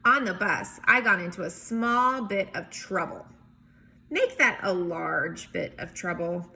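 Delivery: lively picture-book read-aloud; story narration. A narrator's reading. Teacher reading a picture book to kids, lively narration.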